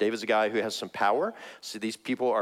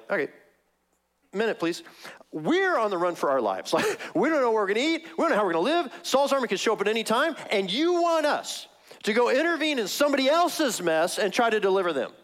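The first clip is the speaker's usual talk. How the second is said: incredulous soldiers; 8 semitones higher than usual. Retold or imitated. Soldiers balking at David's plan, panicky and incredulous. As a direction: sell it as panicked disbelief.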